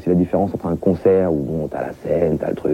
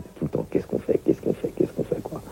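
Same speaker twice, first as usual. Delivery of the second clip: hushed, bored refrain; mock whisper; 7 semitones lower, 10 dB quieter than usual. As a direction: drop to a whisper, repeating the question. Bored refrain of suburban youth.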